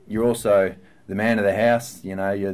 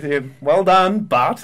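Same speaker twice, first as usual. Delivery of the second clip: mock praise; 8 semitones higher, 8 dB louder, slower than usual. Sarcastic praise to cheeky son.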